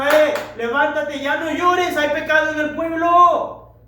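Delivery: firm divine command. Acted. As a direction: order him to stand up, with authority. Dios responde a Josué. (Dios ordenando a Josué con firmeza)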